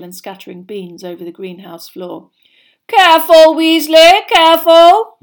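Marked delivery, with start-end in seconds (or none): 2.9-5.2 high, very loud warning cry. A narrator's reading. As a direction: call out an alarmed warning. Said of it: "cried Professor Sprout".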